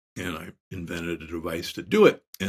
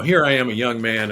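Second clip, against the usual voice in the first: ordinary talk, nothing marked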